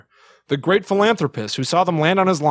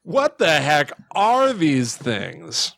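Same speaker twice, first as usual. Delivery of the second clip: slow puzzled quote; much slower than usual. Retold or imitated. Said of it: "he finally went".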